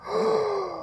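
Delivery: quick, high, breathy mock gasp. Thor realising he could quit being Thor, mock gasp. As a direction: gasp as if struck by a grand idea.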